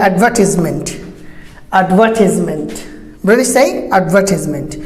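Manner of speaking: advertisement as ad-VER-tis-ment; British advertisement, no "ties" sound; British pronunciation. Shown as a demonstration. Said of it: "So British say".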